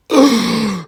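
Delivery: loud, high gasp of disgust; horrified gasp. Real, not acted. Reacting to a chicken put in the freezer, horrified gasp. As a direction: gasp loudly in horror.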